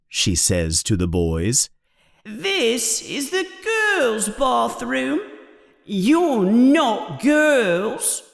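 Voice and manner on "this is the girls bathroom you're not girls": high, slow, drawn-out ghostly whine; plaintive ghost, objecting to intruders. Acted. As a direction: moan it high and slowly. Voices Moaning Myrtle in story.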